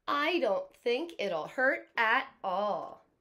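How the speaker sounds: put-on loud, slow, taunting kid. A narrator's reading. Teacher reading to class as the boastful kid, loud and slow. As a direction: boom it out like a cocky kid. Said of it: "Now the kid is speaking".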